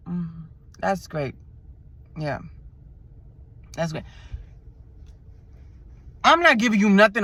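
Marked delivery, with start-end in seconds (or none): 0.1-4.0 slow, softer flat brush-off; uninterested. Retold or imitated. Her answer to the flirting driver, slow soft brush-off. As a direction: shut him down, no interest. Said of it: "Now I go".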